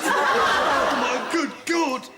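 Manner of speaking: hammed-up dismay; mock shock. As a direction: gasp out in comic horror. Patient reacts to heart removal.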